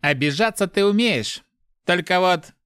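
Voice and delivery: high, needling jab; taunting. A narrator's reading. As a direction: jeer until cut off. Inner voice keeps taunting.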